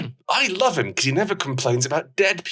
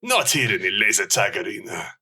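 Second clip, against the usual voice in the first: put-on Spanish accent; 3 semitones lower than usual. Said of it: "traditional Spanish accent".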